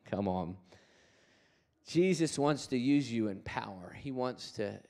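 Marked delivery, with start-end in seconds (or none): none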